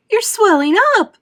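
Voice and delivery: high, loud horrified scream. A narrator's reading. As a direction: shriek in panic. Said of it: "screamed Missus Beauregard".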